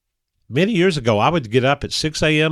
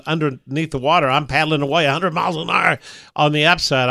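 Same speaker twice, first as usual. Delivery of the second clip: ordinary delivery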